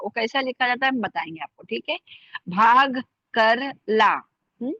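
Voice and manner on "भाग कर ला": भाग with a heavy, aspirated भ, louder and slow. Shown as a demonstration. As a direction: drag out भाग कर ला with a puff on भ. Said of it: "भारी वाला लिखा जाएगा". (भारी भ के साथ धीरे भाग कर ला)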